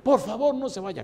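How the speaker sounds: súplica imitada en voz muy aguda; enfermo suplicante. Retold or imitated. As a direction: imita al viejo rogando por un ratito. Enfermo suplica otra vez. (ruego agudo del enfermo)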